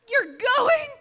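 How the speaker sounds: very high, very loud outraged question; hammed-up shock, player two hearing player one leave; shocked wail. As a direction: wail it in mock disbelief.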